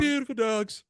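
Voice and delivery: high, loud quoted line; comedian quote. Retold or imitated. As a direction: echo the bit up high.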